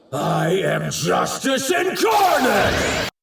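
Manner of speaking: high, slow exultant shout; triumphant proclamation. Acted. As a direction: proclaim your divinity with full force. Villain proclaims his divinity.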